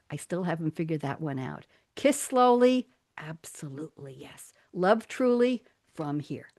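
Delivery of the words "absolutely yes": hushed unvoiced whisper; whispered aside. Host confiding after a line about kissing, whispered. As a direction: drop to an intimate whisper.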